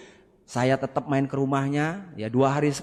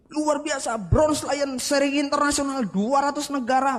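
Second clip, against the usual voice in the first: higher, mock eager sales pitch; pitchman imitation; 8 semitones higher than usual. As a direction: hype it like a pushy presenter. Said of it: "presentasi dengan semangat dan ngotot".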